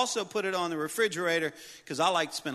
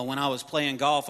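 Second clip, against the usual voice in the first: usual speaking voice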